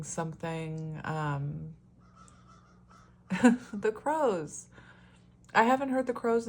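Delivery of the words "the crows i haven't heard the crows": laughing. Real, not acted. Amused by the crows outside.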